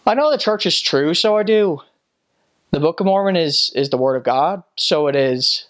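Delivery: Northern Irish testimony, starting high and settling; Northern Irish accent. Acted. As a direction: bear witness with a Northern Irish lilt. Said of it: "Northern Ireland is the only place".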